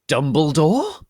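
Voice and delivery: loud, high, rising Dumbledore?; shocked loud question; gasped. Acted. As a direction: gasp out the name in shock. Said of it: "we all gasped".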